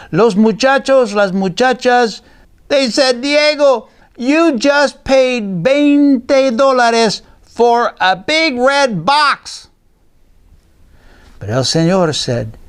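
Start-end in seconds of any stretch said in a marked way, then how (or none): 3.2-9.7 high, slow mocking children; teasing kids. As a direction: drag it out, jeering at him. Kids tease Diego.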